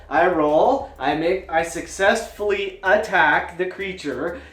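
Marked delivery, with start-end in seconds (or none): none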